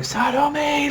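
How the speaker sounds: high, strained call of a name; calling her name. Retold or imitated. Fan retelling the hero reaching for her, high call. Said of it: "he's like".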